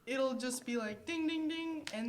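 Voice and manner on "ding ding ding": high-pitched dinging; sing-out ding noises; imitated buzzer bell. Shown as a demonstration. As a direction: mimic a bell going off. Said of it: "What would be the sound".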